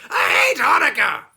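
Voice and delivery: very high, very loud scream; goblin scream. Acted. Trapped goblin screaming at Herschel, very high and loud. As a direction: scream it in despair.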